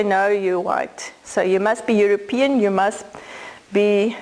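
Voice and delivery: ordinary delivery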